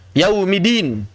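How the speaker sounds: "yaumidin" slow, with a single unheld d; missing tasydid. Shown as a demonstration. Teacher shows another inexact reading, "yaumidin" with single d. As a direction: slide through "yaumidin" without doubling the d. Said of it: "Juga tidak tepat".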